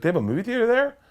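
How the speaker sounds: dad's voice jumps way up in disbelief; high squeak of disbelief; incredulous dad. Retold or imitated. Father asking his son about the date, high and incredulous.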